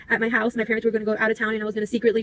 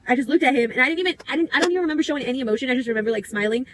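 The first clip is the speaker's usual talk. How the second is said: ordinary talk, nothing marked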